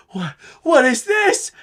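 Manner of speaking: high, slowed, startled question; alarmed shock. Retold or imitated. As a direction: panic as his fingers start disappearing. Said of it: "He's like".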